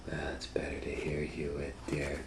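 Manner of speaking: soft whispered sly wolf voice; whispering wolf as grandma. Acted. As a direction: hush it like a wolf hiding in grandma's bed.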